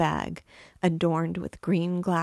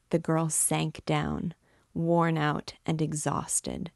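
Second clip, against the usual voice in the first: ordinary delivery, nothing marked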